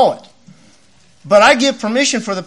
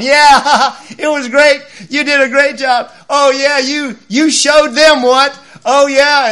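higher, louder gushing fan voice; gushing praise; 6 semitones higher, 6 dB louder, slower than usual. Retold or imitated. Hint of fawning followers cheering the bragging preacher on the bus. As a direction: fawn over him. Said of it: "they're just talking like this".

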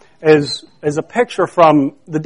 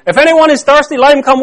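higher-pitched, emphatic proclamation; loud voice; 6 semitones higher, faster than usual. Acted. Preacher repeating Jesus' invitation, loud voice. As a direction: call it out to the crowd. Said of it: "said in a loud voice".

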